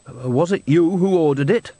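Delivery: sheepish, caught-out question; guilty. A narrator's reading. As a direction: ask it like you've been caught. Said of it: "said Bucket guiltily".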